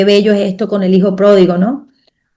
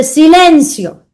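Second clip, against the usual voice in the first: higher and louder, slow and firm command; commanding word; 8 semitones higher, 3 dB louder, much slower than usual. Acted. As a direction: still the storm with one word. Jesus calms the storm. (orden firme y lenta)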